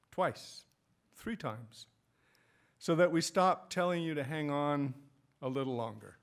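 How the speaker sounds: measured recital with weighty pauses; poem reading. Acted. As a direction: let each phrase land before the next. Reads own poem aloud.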